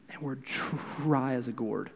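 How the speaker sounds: ordinary talk, nothing marked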